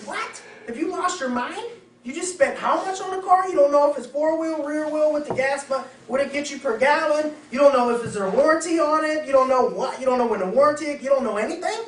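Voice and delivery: high, loud, incredulous scolding; a friend scolding someone who bought a car blind, high, loud, in disbelief; exasperated friend. Acted. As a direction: scold them like a friend who can't believe it. Friend scolds clueless buyer.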